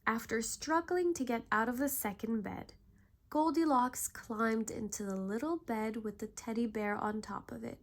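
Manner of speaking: plain reading voice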